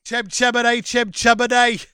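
high, sing-song mock-Cockney. Podcaster parodying the chimney sweep's refrain, hammy Cockney. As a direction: chant it in badly faked Cockney. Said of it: "It got terrible Cockney".

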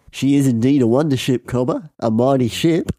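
lilting mock Australian; matey but wayward Australian accent. Tall man agrees about the wonder ship, Australian accent. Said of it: "reference point for an Australian accent".

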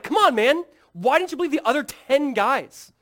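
loud, high-pitched exasperated scolding; mock frustration. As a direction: imitate a fed-up reproach at full volume. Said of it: "He's not frustrated".

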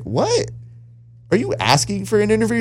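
high, disbelieving what?; surprised. Retold or imitated. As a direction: act floored by the request. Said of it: "And I was like".

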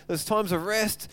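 ordinary talk, nothing marked

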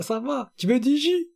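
high sing-song juice offer; Vine character voice. Retold or imitated. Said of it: "il dit, ah".

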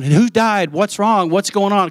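high, urgent what's-wrong panic; alarmed questions. Retold or imitated. Husband hears wife scream on phone, alarmed high questions. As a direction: ask fast, fearing bad news.

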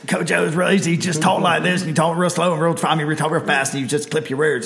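Southern coach voice with clipped words; Ed Ogeron impression. Shown as a demonstration. Podcast host showing how a coach talks, Ed Ogeron voice. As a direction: talk fast and clip your words, comic coach impersonation. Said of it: "pick in Ed Ogeron voice".

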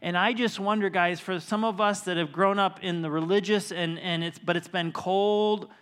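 loud, building sermon appeal to the church; preaching. Acted. Pastor challenging his church, preaching loudly. Preaching at full force to congregation.

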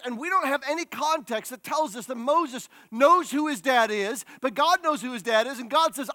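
usual speaking voice

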